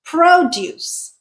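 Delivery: "produce" as PRO-duce, full O; stress on first syllable. Shown as a demonstration. Teacher gives the other way, "produce" stressed on PRO. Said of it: "we could pronounce this word as".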